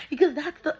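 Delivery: high, loud words through laughter; laughing. Real, not acted. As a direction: keep talking as the laugh spills over. Talks through her own laugh.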